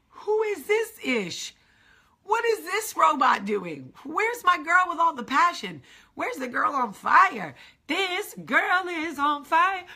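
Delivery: high, slow incredulous voice; baffled and dramatic; incredulous friend. Retold or imitated. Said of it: "Will looked at me like".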